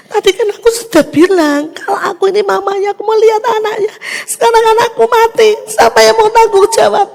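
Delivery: high, loud furious accusation; retold angry outburst. A grieving mother yelling at the nurse who kept her from her baby, angry and loud. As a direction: blame the nurse for the baby's death. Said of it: "saya marah-marah sama susternya".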